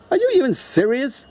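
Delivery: high-pitched incredulous scoff; scoffing disbelief. Real, not acted. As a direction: laugh it off, then question in disbelief. Scoffing laugh at opponent's plans.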